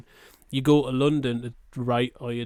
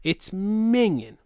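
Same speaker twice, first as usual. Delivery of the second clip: high and slow, truly grossed out; minging said slowly with real disgust; disgusted drawn-out minging; 6 semitones higher, much slower than usual. Real, not acted. Disgust at London river.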